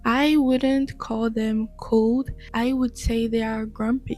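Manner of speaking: normal talking voice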